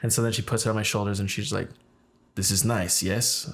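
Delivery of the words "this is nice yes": imitating a Russian hairdresser's accent; thick Russian accent. Hairdresser to client about what's on his shoulders, thick Russian accent. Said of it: "very thick Russian accent".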